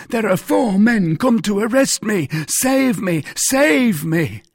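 loud, high-pitched begging; desperate cry. A narrator's reading. Mercer fleeing arrest begs the musketeers, desperate cry. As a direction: plead frantically, crying out for protection. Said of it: "cried he".

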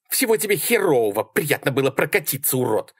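high, indignant farewell insult; high female voice, sarcastic anger; angry parting shot. A narrator's reading. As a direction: throw it back while storming out. Sally leaves cursing him.

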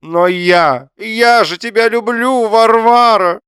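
very high, loud, stretched-out wail; hammed-up lovesick husband; desperate declaration. A narrator's reading. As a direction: beg her melodramatically. Husband declares love, begging her.

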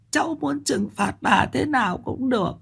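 put-on high, soft old woman's voice, sobbing laughs between. A narrator's reading. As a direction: lament tearfully at the grave. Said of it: "nói những lời não nề thương tâm". (giọng bà lão cao, nức nở não nề)

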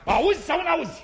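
high-pitched, irritated demand; exasperated challenge. Acted. Clown demands to be heard, exasperated. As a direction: snap the question at a stubborn friend.

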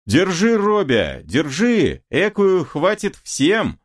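high-pitched rallying call to accomplices; rowdy ruffian urging the others on; urgent calling out. A narrator's reading. Gang holding down a struggling woman.

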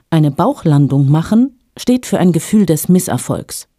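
usual speaking voice

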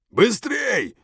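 very high, loud bark; barked order. A narrator's reading. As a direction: snap the order at him. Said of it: "рявкнул старший".

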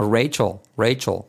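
"Rachel" ending in a backed 'ol'; English dark L. Shown as a demonstration. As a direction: swallow the L at the end of Rachel. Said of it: "Así es la L".

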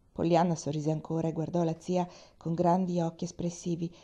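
usual speaking voice

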